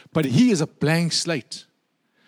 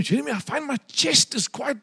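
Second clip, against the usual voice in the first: put-on elderly woman; 4 semitones higher than usual. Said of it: "She said to me".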